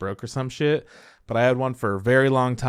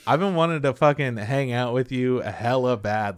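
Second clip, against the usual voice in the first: ordinary talk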